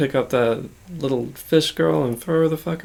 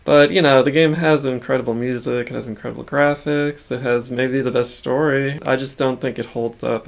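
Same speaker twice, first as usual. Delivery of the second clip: ordinary talk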